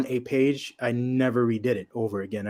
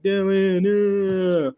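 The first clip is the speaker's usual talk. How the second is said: higher, drawn-out mock moan; drunken pining; 8 semitones higher, 4 dB louder, much slower than usual. Imitating drunk man pining for Dylan.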